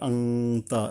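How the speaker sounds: আংতা with a nasal ং, no clear ন; hidden nasal. Shown as a demonstration. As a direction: hum the ং in আংতা. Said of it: "মনে রাখতে হবে".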